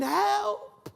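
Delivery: high, strained calls for help from below; shouting for help. Pretend. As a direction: call up from the hole for help. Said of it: "you're shouting".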